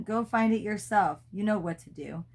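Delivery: plain reading voice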